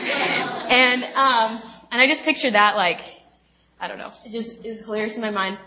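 ordinary talk

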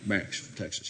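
"Max from Texas" said softly with slushy sh sounds; Max's Texan pronunciation. Retold or imitated. Imitating a seminary friend, soft slushy "Max from Texas". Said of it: "That's how he said it".

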